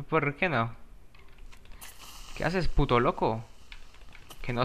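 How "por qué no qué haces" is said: ordinary talk, nothing marked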